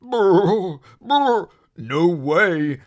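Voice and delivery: loud shivering brr, teeth chattering; shivering from cold. Acted. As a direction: shake the words out as the cold water bites. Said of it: "he would shiver".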